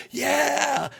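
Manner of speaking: high triumphant yell; celebratory yell. Retold or imitated. Wrestler yelling to the crowd from the turnbuckle, triumphant. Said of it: "he's like".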